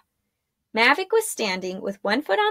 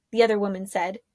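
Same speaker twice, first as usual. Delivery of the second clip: ordinary delivery, nothing marked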